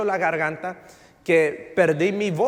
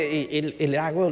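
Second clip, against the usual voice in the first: usual speaking voice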